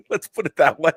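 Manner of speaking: laughing through the words; chuckling as he undercuts himself. Real, not acted. Guest laughing at his own convention stories.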